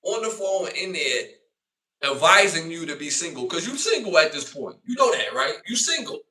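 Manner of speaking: high, loud taunting; heated put-down. Retold or imitated. Telling the woman she's single now, loud taunt. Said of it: "I'm like".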